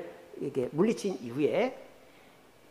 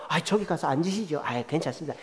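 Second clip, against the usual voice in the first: softer, lower polite exchange; modest politeness; 5 semitones lower, 5 dB quieter than usual. Acted. Offering a seat and politely declining, modest and soft. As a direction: voice a humble, low-key courtesy.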